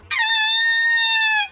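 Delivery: shrill high-pitched squeal as he falls; falling player's comic high squeal; high mock scream. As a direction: squeal way up high like a cartoon fall. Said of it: "his reaction was to go".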